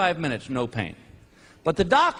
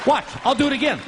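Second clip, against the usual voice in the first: shouted higher, cocky; mock boastful shout; 8 semitones higher, faster than usual. Telling the audience to watch him dodge again, cocky shout. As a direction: show off dodging like it's easy. Acting out dodging lineman.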